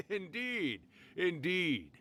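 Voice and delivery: a knowing chuckle breaking into the word; amused laugh. Acted. Old fox laughing at the guess, amused chuckle.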